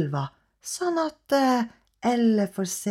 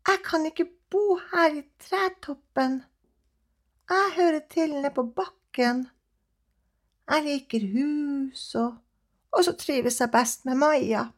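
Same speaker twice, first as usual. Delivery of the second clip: cat voice; 6 semitones higher than usual. Acted. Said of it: "sier Elle".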